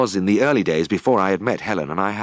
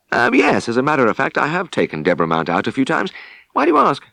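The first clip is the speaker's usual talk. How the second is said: caught out; 8 semitones higher, 4 dB louder than usual. Acted. Tristan caught out, defensive.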